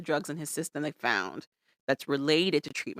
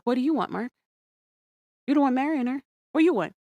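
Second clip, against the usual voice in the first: higher, insistent mock questioning; imitated exasperation; 7 semitones higher than usual. Confronting Mark about marrying her, mock exasperated. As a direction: press him for an answer, retold.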